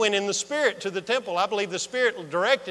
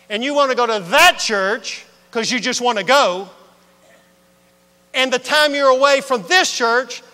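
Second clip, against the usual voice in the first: loud preaching build-up; sermon voice; 6 semitones higher, 10 dB louder than usual. Shown as a demonstration. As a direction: preach it out with full force. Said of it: "that's a sermon voice".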